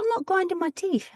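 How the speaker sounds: a mock-offended denial; indignant denial. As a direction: protest it, up in pitch. Retold denial to doctor.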